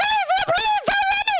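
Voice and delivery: very high desperate plea; high hammed-up begging; pleading shriek. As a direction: beg her in a squeal. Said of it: "I'm like".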